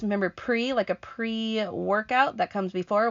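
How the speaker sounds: ordinary delivery, nothing marked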